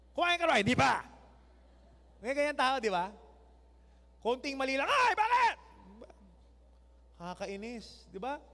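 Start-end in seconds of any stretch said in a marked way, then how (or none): 0.1-1.1 imitated grumpy snap. Grumpy person snapping, imitated. 4.9-5.6 high, loud exasperated "Ay, bakit?"; mock irritated outburst. Irritable person reacting to mistake.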